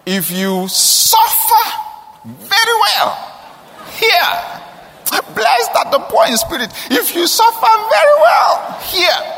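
high, slow, building to a shout; preaching crescendo. Retold or imitated. Preacher mocking old belief, climbing into a slow shout. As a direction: soar up as if proclaiming scripture.

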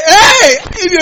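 loud, high Hey! of shock; startled outburst; shocked cry. Retold or imitated. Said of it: "He was shocked".

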